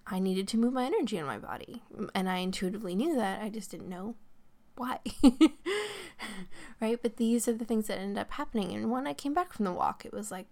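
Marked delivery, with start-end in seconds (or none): 5.0-6.7 loud laugh. Real, not acted. To podcast listeners, laughing loudly at herself. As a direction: burst of real laughter.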